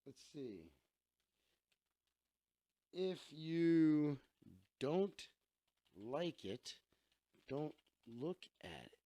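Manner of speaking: near-silent dictation to himself, word by word; murmured while typing. Real, not acted. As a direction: mumble it under your breath as you type it out. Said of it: "what do I write on this one".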